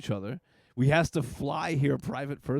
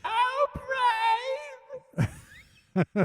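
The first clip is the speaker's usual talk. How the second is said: cartoon sidekick falsetto. Cartoon impression, repeated.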